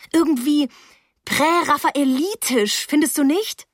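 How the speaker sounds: high-pitched, admiring musing; gushing. A narrator's reading. Teenage girl seeking the boy's agreement about her friend, gushing. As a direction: linger on the big word, high and admiring.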